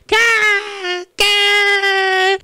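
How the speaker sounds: high loud cawing; crow caws. Acted. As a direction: caw like a crow. Mimicking crows.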